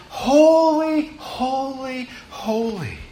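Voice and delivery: high, hushed call of worship; angels crying out. Acted. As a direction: echo a reverent angelic call, sustained and fading. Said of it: "the angels cried out to each other".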